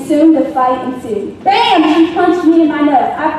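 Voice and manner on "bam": punch sound effect. Retold or imitated.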